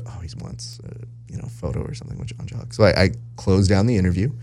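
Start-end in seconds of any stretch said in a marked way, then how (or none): 0.1-2.7 whispered aside, very soft; whispered thought. Retold or imitated. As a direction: murmur it to yourself. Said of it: "and I go".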